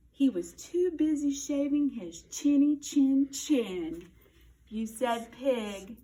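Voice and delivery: usual speaking voice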